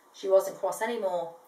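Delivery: ordinary delivery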